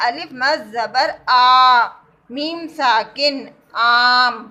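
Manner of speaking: teacher stressing the long vowel; big long aa. Shown as a demonstration. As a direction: make the big آ sound. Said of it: "بڑے آ کی آواز نکالنی ہے".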